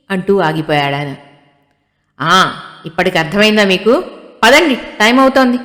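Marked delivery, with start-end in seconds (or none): 2.2-5.6 louder, higher exasperated tease; irritated laughing chide. Acted. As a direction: scold him with a half laugh. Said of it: "భర్త మీద మెల్లగా విసుక్కుంటూ నవ్వింది".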